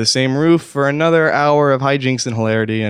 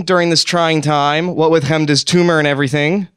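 angry rant; 5 semitones higher than usual. Acted. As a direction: vent your hurt and fury. Angry rant, friends late.

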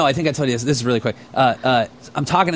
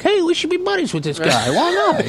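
high, eager mock friend-making; Ray voice. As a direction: imitate an overgrown kid pitching a new buddy. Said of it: "Ray invited him to, like".

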